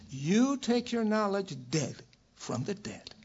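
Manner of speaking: ordinary talk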